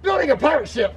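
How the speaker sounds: high-pitched, disbelieving echo; incredulous dad. Acted. Dad questions the pirate ship, high and incredulous. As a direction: repeat it back in disbelief.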